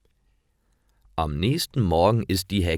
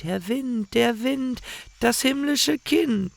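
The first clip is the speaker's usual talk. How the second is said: put-on high, light child's voice chanting the rhyme; sing-song children's voice. A narrator's reading. Hansel and Gretel answer the witch in high innocent chant.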